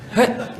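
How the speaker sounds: one high, abrupt "huh", like shooing a cat; stern shooing grunt. Retold or imitated. Son imitates his father calling him, sharp shooing grunt. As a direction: mimic a stern father's wordless call. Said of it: "Dia bapak saya macam tu garang".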